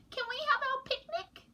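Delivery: put-on very high, small child voice; little penguin voice. A narrator's reading. Penguin chick Pog pleads with parents, squeaky high. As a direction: ask like an eager tiny chick. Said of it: "said Pog".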